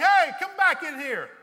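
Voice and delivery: hammed-up husband's yell, high and loud; retold shout. Husband calling his wife back from the bedroom, mock shout. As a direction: bellow it after her like the startled husband. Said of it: "he was shouting".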